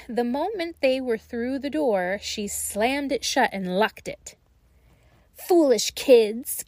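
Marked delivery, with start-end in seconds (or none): 5.3-6.6 slow, louder witchy cackle; cackling witch. Acted. Witch slams the door and gloats at the children, slow cackling voice. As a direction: gloat over them like a wicked witch. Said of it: "cackled the old woman".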